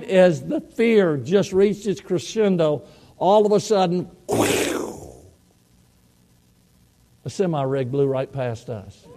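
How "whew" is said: voiceless whoosh of a passing truck; whooshing noise. Retold or imitated. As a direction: blow out a rushing-wind sound effect. Imitates semi-rig blowing past.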